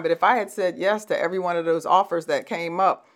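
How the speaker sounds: normal talking voice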